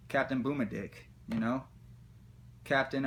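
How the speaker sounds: normal talking voice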